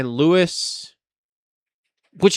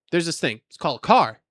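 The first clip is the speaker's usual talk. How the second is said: ordinary talk, nothing marked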